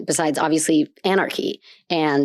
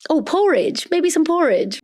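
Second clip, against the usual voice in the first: mock English food suggestion; 10 semitones higher than usual.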